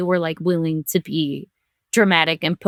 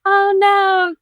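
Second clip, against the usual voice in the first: dawning realization; 11 semitones higher, 4 dB louder than usual. Retold or imitated. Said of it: "was the realization, I'm crying".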